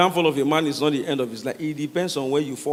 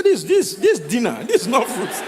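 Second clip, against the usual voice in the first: outraged disbelief; 5 semitones higher than usual. Retold or imitated. Pastor outraged at banana.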